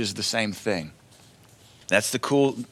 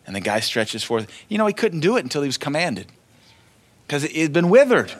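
usual speaking voice

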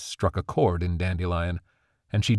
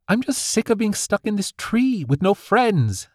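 higher, frustrated outburst; exploding; 13 semitones higher, 3 dB louder than usual. A narrator's reading. Daughter exploding at her strict mother. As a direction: burst out in teenage frustration. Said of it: "Dandelion exploded".

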